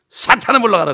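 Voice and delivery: imitating a pastor shouting at Satan; mock rebuke. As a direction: cast the devil out loudly. Pastor rebuking demon in prayer.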